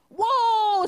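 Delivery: high, loud startled whoa. Acted. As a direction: yelp as the deck slips away. Horses stumble on slick deck.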